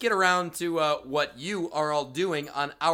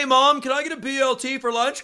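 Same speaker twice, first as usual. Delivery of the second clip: mock shout; 8 semitones higher, 5 dB louder than usual. Joke voicing eagle cry attached.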